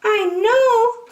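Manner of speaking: very high, loud piping squeak; tiny high mayor piping. Acted. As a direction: pipe it up from far below. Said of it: "called the voice".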